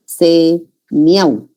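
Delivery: "Semiau" broken as se-miau, two beats; split into syllables. Shown as a demonstration. Teacher separating the word for students, "Semiau" split se-miau. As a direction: say "se", pause, then "miau" as one syllable in "Semiau". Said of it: "Esto vamos a separar así".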